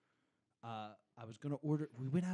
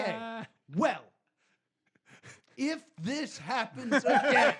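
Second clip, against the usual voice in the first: laughing stern; 10 semitones higher, 13 dB louder, slower than usual. Retold or imitated. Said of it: "I was like".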